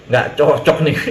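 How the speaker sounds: chuckling low at himself; the host laughing that gossip breaks his fast. Real, not acted. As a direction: laugh it off, amused.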